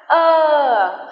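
a drawn-out "ơ", held long; long er vowel. Shown as a demonstration. Teacher modelling the long er vowel. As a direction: hold the "ơ" sound long. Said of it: "âm ơ dài".

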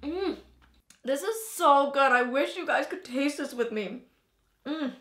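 talking through a mouthful of donut; words round a bite of dough; mouth full. Real, not acted. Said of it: "talking with my mouth with dough in it".

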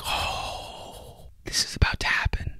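awed whisper. Retold or imitated. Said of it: "And you're just like".

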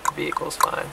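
breathy whisper near a wild animal. Real, not acted. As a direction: whisper so the elk stays calm.

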